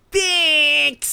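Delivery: loud, high drawn-out thanks; imitating a bratty child; suddenly cheerful kid. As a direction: drop the tears and chirp it brazenly. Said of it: "he stops crying and goes".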